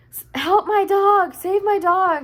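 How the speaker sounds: high, loud mock cry for help; imitated tearful plea. Imitating a dog owner chasing her runaway dog, near-tears pleading. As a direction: act out a panicked owner pleading, drawn out. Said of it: "you could hear it in her voice that she was about to cry".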